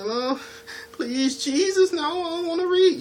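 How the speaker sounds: very high, pleading whine; mock whiny non-reader. Mocking viewers who hate subtitles, in a high whine. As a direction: whine like someone begging not to read. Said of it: "looking at it like".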